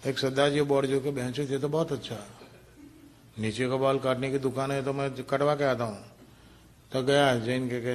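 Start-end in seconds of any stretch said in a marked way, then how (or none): 1.1-6.0 soft Punjabi-Hindi Sardarji voice; Sardarji Hindi accent. Acted. Sardarji reads the barber's board, in a soft Punjabi-Hindi accent.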